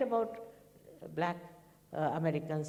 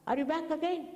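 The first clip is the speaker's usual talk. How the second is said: delighted greeting; 8 semitones higher than usual. Retold or imitated. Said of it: "He said".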